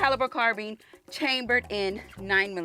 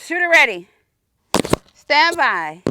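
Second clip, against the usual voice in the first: range command call; 5 semitones higher, 9 dB louder, slower than usual. Retold or imitated. Range officer start commands.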